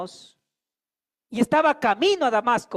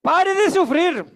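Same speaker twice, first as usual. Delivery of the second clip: higher, louder, slow pleading to a brother; slow, raised plea: stop fighting God; preaching exhortation; 6 semitones higher, 6 dB louder than usual. Shown as a demonstration. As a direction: urge the person beside you, slowly and forcefully. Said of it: "Dígale".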